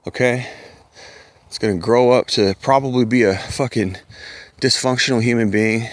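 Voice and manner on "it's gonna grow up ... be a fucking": normal talking voice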